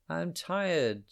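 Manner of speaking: sing-song a tired little bird's voice. Acted. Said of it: "sang she".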